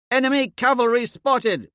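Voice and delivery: high, loud warning cry from a rooftop; calling down. A narrator's reading. Thraxa warning the commander of cavalry, shouting. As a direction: call it down to the street below. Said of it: "she calls down to me".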